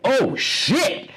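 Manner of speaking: high-pitched exclamation, re-enacted; reliving a startled cry on a plane; retold loud outburst. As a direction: blurt it out high in mock shock. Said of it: "I quite loudly went".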